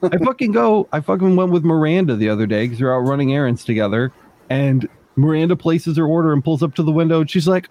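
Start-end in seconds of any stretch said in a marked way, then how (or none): none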